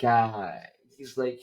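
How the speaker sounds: normal talking voice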